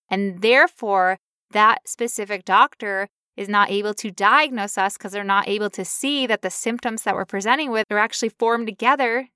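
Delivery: normal talking voice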